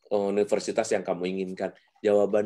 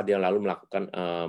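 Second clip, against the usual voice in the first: ordinary delivery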